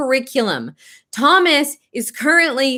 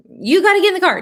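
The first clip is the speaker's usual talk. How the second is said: higher, a mock parental order; mock stern mother; 8 semitones higher, 3 dB louder, much faster than usual. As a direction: play the exasperated mom insisting. Mother and son quarrel retold.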